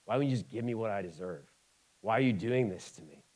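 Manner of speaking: low, soft self-pitying complaint; a hint of a grumbling sulk; mock grumbling whine. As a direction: whine it under your breath like a sulking believer. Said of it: "And I grumble".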